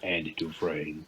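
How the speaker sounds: low, slow, hushed narrator voice; Morgan Freeman. Acted. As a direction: draw the name out deep and soft. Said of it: "Like Morgan Freeman".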